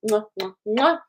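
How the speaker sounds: quick string of air kisses; kiss sounds. Real, not acted. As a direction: blow three playful kisses. Blowing kisses to viewers.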